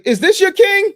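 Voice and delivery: loud, very high-pitched movie-villain shout; high, booming mock-villain yell; Killmonger. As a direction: shout it out like a challenge to a crowd. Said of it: "what did Killmonger say?".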